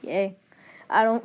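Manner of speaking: ordinary delivery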